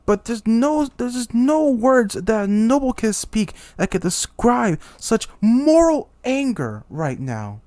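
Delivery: high, loud indignant king; outraged rant. Acted. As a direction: rant in wounded royal fury. Humiliated king ranting in outrage.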